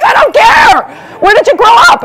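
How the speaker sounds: very high, loud, slow exasperated yell; hammed-up impatient Canadian yelling; mock shout. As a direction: shout it over them, fed up. Canadian shouts back, unimpressed.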